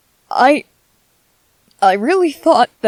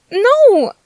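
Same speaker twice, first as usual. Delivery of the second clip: high, loud wail of protest; dramatic "no" of dismay; dismayed cry; 11 semitones higher, 5 dB louder than usual. Acted. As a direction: cry out in horrified disbelief. Dismayed at not getting to eat.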